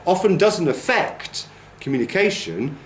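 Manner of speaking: normal talking voice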